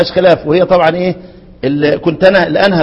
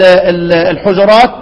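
ordinary talk

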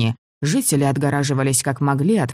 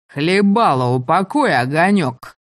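loud, higher leering pirate snarl; grinning pirate; 3 semitones higher, 4 dB louder than usual. A narrator's reading. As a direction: sneer it like a thug. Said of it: "осклабился один из пиратов".

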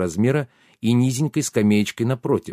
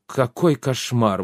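usual speaking voice

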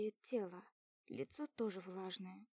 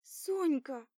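loud, higher call; excited cry; 4 semitones higher, 8 dB louder than usual. Acted. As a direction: cry out the name in relief. Sister crying out on waking.

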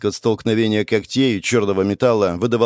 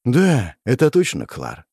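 ordinary delivery, nothing marked

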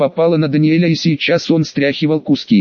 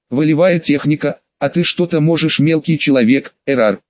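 plain reading voice

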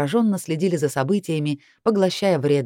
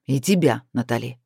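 low, grudging male growl; snarled reply; 5 semitones lower than usual. A narrator's reading. Chris ending Natalie's call, snarling. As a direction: return the greeting in a low growl. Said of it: "рыкнул он".

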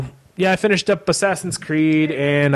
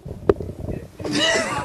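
high squeaky laugh; 5 dB quieter than usual. Real, not acted. Bursts out laughing at question.

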